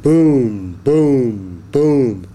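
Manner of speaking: heavy booms, each one falling; loud booming. A narrator's reading. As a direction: boom out like giant footsteps. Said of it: "The sound got louder".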